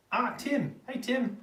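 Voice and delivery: high, loud hello to a friend; excited greeting. Real, not acted. Streamer greets Tim as he joins the chat, excited. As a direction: call out brightly on spotting a familiar name.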